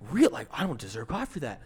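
mildly imitated disbelief; weird incredulous tone. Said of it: "he gets that weird tone".

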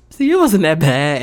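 loud imitation of an amused official; teasing DMV man. DMV man teasing her after the photo, amused. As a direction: act out his loud, cheeky reassurance.

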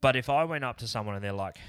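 normal talking voice

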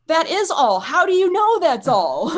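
high, loud, stretched-out disbelief; astonished retort. Retold or imitated. Client answering healer who read her feeling, astonished. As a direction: blurt it back, amazed and incredulous.

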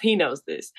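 ordinary talk

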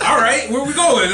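loud, high mock Southern drawl. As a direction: playfully imitate a Southern drawl. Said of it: "that Southern drawl".

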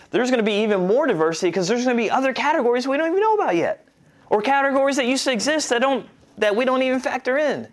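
fast, high, tumbling enthusiasm; excited rush. Real, not acted. Enthused burst about future diversity.

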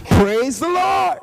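high, loud, slow Praise the Lord; hammed-up joyful shout; mock praise. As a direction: cheer it out like a church shout. Pastor's mock celebration.

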